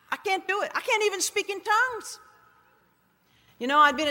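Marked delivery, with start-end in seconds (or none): 0.1-2.2 high, helpless objection; small, high-pitched protest; retold protest. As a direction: plead that you are not capable. Protesting to God her unfitness.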